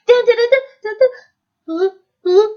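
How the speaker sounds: high, loud wild dun-dun; crazy. Shown as a demonstration. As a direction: freak out on the drum sound. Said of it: "freak out and be crazy".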